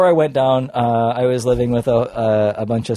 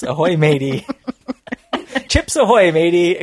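louder hammed-up "Ahoy, matey"; mock pirate greeting; 4 semitones higher, 6 dB louder than usual. Retelling his greeting to the restaurant owner, hammed-up pirate voice. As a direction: bellow it like a cartoon pirate. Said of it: "Ahoy, matey. That's what you said".

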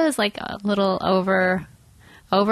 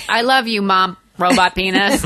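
robot voice; 6 dB louder than usual. Retold or imitated. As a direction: say it like a droning machine. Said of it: "that's how he said it".

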